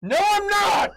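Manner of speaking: loud high yell into the mic. Real, not acted. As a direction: shout the denial. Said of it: "yelling into the microphone".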